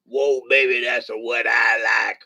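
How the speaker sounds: hammed-up rock and roll shout; [name] voice. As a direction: belt out the hook.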